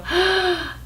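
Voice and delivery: high gasp of surprise; surprised gasp. Shown as a demonstration. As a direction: gasp like a friend just showed up unannounced. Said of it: "and you go".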